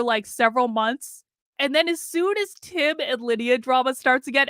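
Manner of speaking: ordinary delivery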